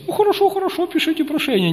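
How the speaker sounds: put-on very high-pitched old bishop voice; little old grandpa. Elderly bishop agreeing to admit a student, high little grandpa voice. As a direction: imitate a tiny old man granting a request. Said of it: "маленький, седенький, э, дедушка".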